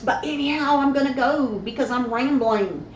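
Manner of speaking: high, loud, gushing sign-off; bubbly goodbye. Real, not acted. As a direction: beam it out to your fans. Cheery sign-off to viewers.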